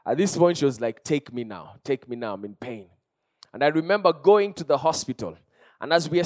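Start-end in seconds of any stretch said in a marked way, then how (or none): 1.0-2.9 low, soft voicing of a sick child; hushed, understated pleading; retold plea in pain. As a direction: quote the suffering daughter softly and low. Voices his sick daughter pleading.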